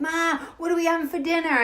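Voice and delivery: put-on high-pitched kid yelling to mom; mock kid scream. Kids to mother on the porch, mock scream. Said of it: "and we'd scream".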